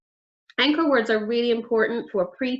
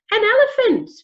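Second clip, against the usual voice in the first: mock amazement; 12 semitones higher, 8 dB louder than usual. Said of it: "mock surprise at a gift".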